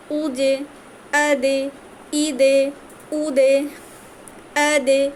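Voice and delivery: আজে and আদে with জ and দ bounced; qalqalah drill. Shown as a demonstration. Teacher drills students on জ and দ, bounced. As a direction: push জ and দ off with an echo. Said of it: "এভাবে পড়বেন ধাক্কা দিয়ে".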